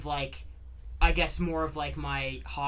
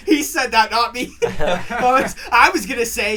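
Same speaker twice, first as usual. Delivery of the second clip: words bursting through laughter; laughing hard; 8 semitones higher, 15 dB louder than usual. Real, not acted. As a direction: laugh through every word. Laughing hard at friend's joke.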